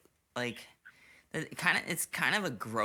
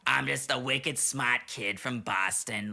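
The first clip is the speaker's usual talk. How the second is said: slow, hammed-up Boston kid; drawling mock Boston accent. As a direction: play a movie Bostonian. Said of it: "like Good Will Hunting".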